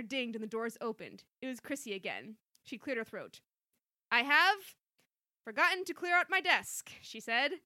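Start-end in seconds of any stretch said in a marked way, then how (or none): 4.1-6.9 loud awkward announcement. Acted. Chrissy returns, awkward and loud.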